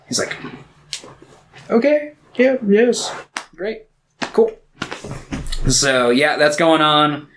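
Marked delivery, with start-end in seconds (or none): 1.7-4.6 high, halting little yeses; meek agreement. Retold or imitated. Captured Gollum agreeing to lead them to Mordor, meek and high. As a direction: squeak out each word separately, mock-Gollum.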